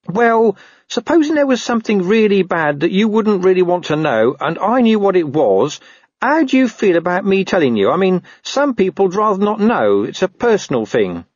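high-pitched, uneasy dog skirting bad news; hedging talking dog. Dog sounds out Carrot before bad news, hedging talking dog.

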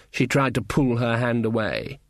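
plain reading voice

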